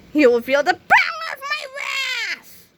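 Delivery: very high, drawn-out shout; slow climactic threat, stretched to the last word; villain's threatening shout. A narrator's reading. Big Melvin threatens Captain Underpants, high villainous shout.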